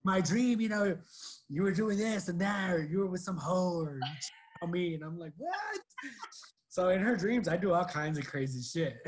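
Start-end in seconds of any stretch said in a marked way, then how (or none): 5.4-5.8 high, startled what; shocked squeak. Retold or imitated. Boyfriend hearing he cheated in her dream, squeaking in shock. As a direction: squeal it in disbelief.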